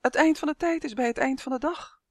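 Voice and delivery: small, high-pitched squeak of a voice; very small creature, voiced high; tiny little voice. A narrator's reading. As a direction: pipe up high like something tiny. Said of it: "een piepklein stemmetje".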